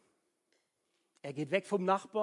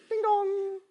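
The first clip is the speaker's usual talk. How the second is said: doorbell ding dong; 10 dB louder than usual. Pretend. Ringing the neighbour's doorbell again.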